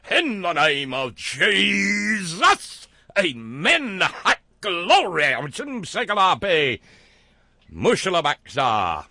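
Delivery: loud, drawn-out revival-preacher cry, pitched high; hammed-up holy-roller shouting, stretching the words; mock fervent preacher. Mocking preacher's fervent prayer and tongues.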